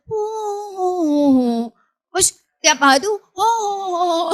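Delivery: high, strangled mute oh sounds; mute grunting. Acted. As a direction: try to speak but only grunt oh. Mute Zakaria again.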